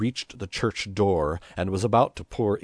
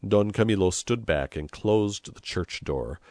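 plain reading voice

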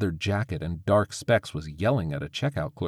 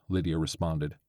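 plain reading voice

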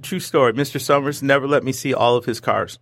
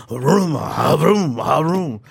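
engine vroom; 2 semitones higher, much slower than usual. Retold or imitated. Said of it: "and I go".

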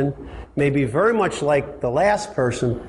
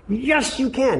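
much higher, firm yes you can; emphatic answer; 10 semitones higher, slower than usual. Retold or imitated. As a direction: insist they can. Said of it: "some of you are them".

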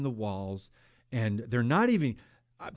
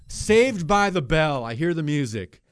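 normal talking voice

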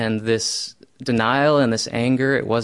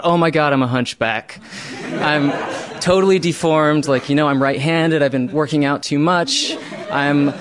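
mock panic; 4 semitones higher, 4 dB louder than usual. Retold panic about being deformed.

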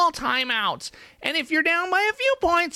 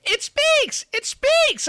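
excited; 10 semitones higher, 3 dB louder, much slower than usual. Acted. Mad scientist's creation comes alive.

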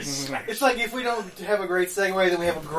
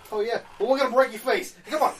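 Brooklyn mobster; 6 semitones higher than usual. Pretend. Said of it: "like a Brooklyn mobster".

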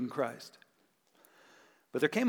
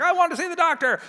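whiny demand; 12 semitones higher, 4 dB louder, faster than usual. Retold or imitated. Frustrated patient demanding the doctor.

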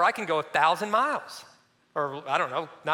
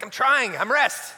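higher, louder mock protest; defensive protest; 5 semitones higher, 6 dB louder, slower than usual. Husband protesting to his wife, mock defensive and higher. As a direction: insist you're resting when you're not. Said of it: "I'm like".

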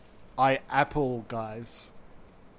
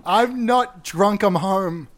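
trying to sound sober; 6 semitones higher, 5 dB louder, much slower than usual. Acted. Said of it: "[name], you're drunk".

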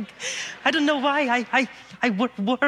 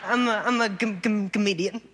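stutter; 4 semitones higher than usual. Real, not acted. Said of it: "I have a stutter".

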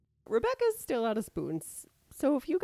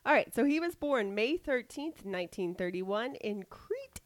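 usual speaking voice

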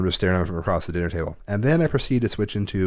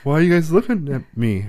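higher, put-on clueless kid; innocent kid voice; 5 semitones higher than usual. Mother voicing her son's baffled innocence, higher.

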